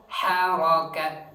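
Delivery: normal talking voice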